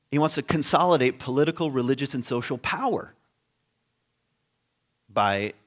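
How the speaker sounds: ordinary talk, nothing marked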